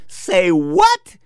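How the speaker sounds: high-pitched incredulous question; shocked disbelief. Retold or imitated. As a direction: blurt it out in mock shock, pitch leaping up. Shock, set against the silent take.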